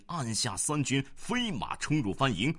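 plain reading voice

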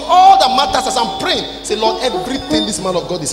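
ordinary talk, nothing marked

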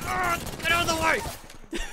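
high, slow, loud cry; panicked yell. Real, not acted. Player blocked while fleeing, panicked yell. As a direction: bellow to clear the path.

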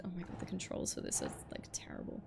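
muttered under breath; quiet annoyed aside; whispered grumble. Real, not acted. Grumbling at the terrible controls, whispered.

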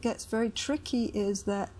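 normal talking voice

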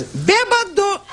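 very high squeaky taunting call of bêbado; shrill-pitched mocking snake calling out.

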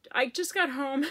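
a real teary laugh breaking in; laughing through tears. Real, not acted. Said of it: "It's so silly".

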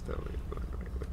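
soft fast whisper to himself; quick whispered repeat; whispered plea. Real, not acted. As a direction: breathe the plea almost silently. Repeats plea under breath.

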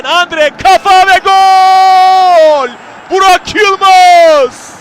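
long drawn-out goal scream; screaming football commentator. Real, not acted. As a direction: stretch the goal call out at full volume. Goal call in live commentary.

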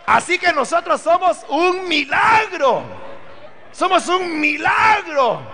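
slow, ringing pulpit proclamation, every phrase hammered home; preaching at full force. Acted. Preacher to his congregation about the new creation, full-force preaching.

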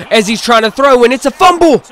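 high, loud, building play-by-play; sportscaster shouting the turnover; excited fumble call. Real, not acted. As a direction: burst out as the ball comes loose. Calling a fumble live.

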